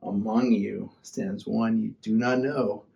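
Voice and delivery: slow, soft, sassy passive-aggressive read. Pretend. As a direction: say it like a smug little dig. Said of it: "you're sassy and kind of passive aggressive".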